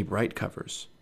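ordinary delivery

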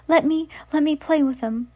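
high-pitched eager witch voice. Acted. Witch begging her sister for a plaything, eager.

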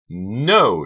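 high, very loud scream. A narrator's reading. Karina blocks the doors, screaming. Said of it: "she screamed".

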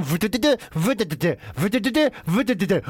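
stuttering buzz and tick; imitated friction noise. Comic imitating dry friction, juddering mouth noise. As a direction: sputter out a rhythmic juddering noise. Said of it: "you're still going".

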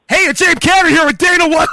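high, loud radio interview opener; booming recorded intro. Retold or imitated. Host showing how taped interviews jump out, loud intro. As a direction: jump up into a big, projected host voice. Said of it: "it would be completely different".

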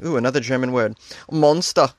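normal talking voice

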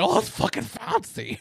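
high, slow and snooty, mocking a British accent; mock posh British. American podcaster mocking British accents, high and slow posh voice. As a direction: sneer it out like an awestruck American faking British. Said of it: "Anything with an accent".

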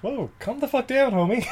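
high, laughing mock calm. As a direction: coax him with a laugh. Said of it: "I'm like".

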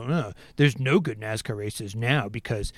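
lightly voiced dumb hater whining about his flag. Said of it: "they go".

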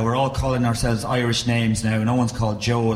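ordinary talk, nothing marked

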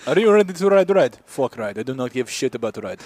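mock Russian rider.

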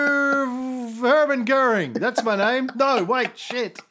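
panicked [name]. Retold or imitated. [name] acting out sneeze.

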